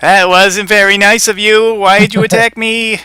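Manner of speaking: high-pitched wounded protest from a bunny; aggrieved Easter Bunny. Acted. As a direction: whine it loudly in a high cartoon rabbit voice.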